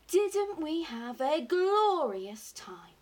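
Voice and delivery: loud, high, slowly relished delight; rapturous exclamation; excited cry. A narrator's reading. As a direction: revel in it, stretching the words. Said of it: "he cried".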